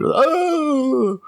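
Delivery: a yelped "Oh!" jumping high; mock high surprised gasp. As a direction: squeal it out in mock shock.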